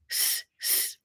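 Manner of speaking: unvoiced sss like calling cows; hissing call. Man at the shop hissing at a passing girl, sss call. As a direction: hiss to get a girl's attention.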